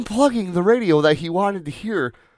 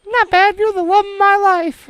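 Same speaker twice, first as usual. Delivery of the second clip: high squeaky falsetto wife; hammed-up falsetto impression of his wife; mock wife voice; 6 dB louder than usual. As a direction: play the wife in a silly high voice. Mock phone call with wife.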